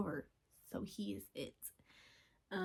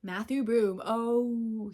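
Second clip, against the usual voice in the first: gushing; 8 dB louder, much slower than usual. Real, not acted. Gushing over the actor.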